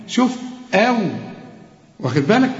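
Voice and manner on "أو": أو as a thin, fronted "aew"; light hamza. Shown as a demonstration. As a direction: isolate the light أو ending. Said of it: "فخم لي بس الإيه؟". (أو بهمزة مرققة)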